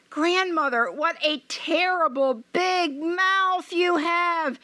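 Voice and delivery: put-on high, slow, wondering girl; a child's uneasy wonder; high hesitant girl's voice; little girl voice.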